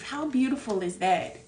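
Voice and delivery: ordinary talk, nothing marked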